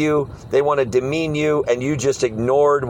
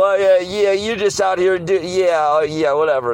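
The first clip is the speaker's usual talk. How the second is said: imitating a high, stumbling brush-off; flustered mocker; 6 semitones higher than usual. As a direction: sputter a mock dismissal, pitch falling away. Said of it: "Usually their lip starts quivering".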